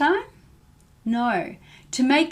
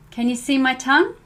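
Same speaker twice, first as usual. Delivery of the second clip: ordinary talk, nothing marked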